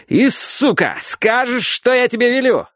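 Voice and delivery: high furious yell; furious shouting. Acted. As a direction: scream the order at him. Father threatening son violently.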